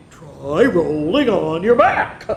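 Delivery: slow, laughing pig voice; snorted laugh. Acted. Pig laughing at the hedgehog, slow and snorting. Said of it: "snorted Pig".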